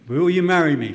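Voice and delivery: the proposal repeated loud and clear. Shown as a demonstration. Preacher repeats the proposal loudly so the tape catches it. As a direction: boom it out for the tape. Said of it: "For the sake of the tape".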